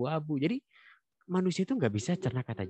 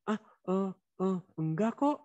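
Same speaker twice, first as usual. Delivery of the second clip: put-on stammering child; 9 semitones higher than usual. Child caught, stammering denial.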